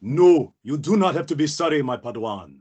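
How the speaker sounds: put-on firm, accented Jedi reassurance; Kit Fisto. Jedi reassuring an apologetic Padawan, firm then gentle. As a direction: absolve them like a wise master. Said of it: "Oh my God, Kit Fi".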